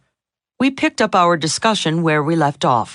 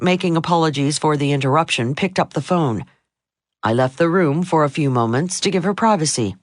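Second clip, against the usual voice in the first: ordinary talk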